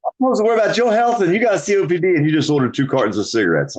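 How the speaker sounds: fast, high, sarcastic outrage; mocking a hypocrite, high and quick; exasperated mock rant. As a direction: rattle it off, disbelieving and put out. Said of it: "It's like".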